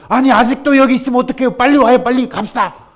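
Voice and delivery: loud, high, fast urging; second rescuer shouts at the stubborn man; urgent calling. Acted. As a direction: hurry him into the boat. Second rescuer urges him.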